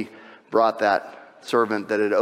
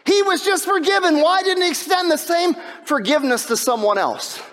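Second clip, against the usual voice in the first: high, loud, impassioned preaching; preaching at full force; 3 dB louder than usual. Acted. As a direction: build the story into a fiery sermon peak. Preacher builds to climax.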